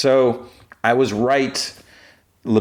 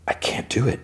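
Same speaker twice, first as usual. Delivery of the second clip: whispered refusal; 5 semitones higher, 6 dB quieter than usual. Acted. Mensah refusing to cut his spine.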